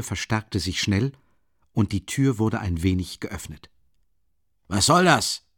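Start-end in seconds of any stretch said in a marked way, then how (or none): 4.7-5.4 sharp, louder angry question; angry call. A narrator's reading. Porfiri shouting at the noise behind the door, angry. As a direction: snap it at the door in irritation. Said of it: "rief Porfiri Petrowitsch ärgerlich".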